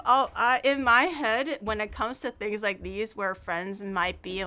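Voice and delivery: normal talking voice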